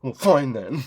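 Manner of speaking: put-on petulant giving in; stomp-your-foot sulk, played for laughs; mock childish huff. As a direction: imitate a huffy small child. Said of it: "he stomped his foot like a four-year-old".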